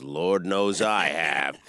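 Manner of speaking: deep, slow deadpan delivery; Boyd Banks line. Retold or imitated. Quoting the movie line to the hosts, low and slow. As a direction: drag it out low and dry. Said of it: "Boyd goes".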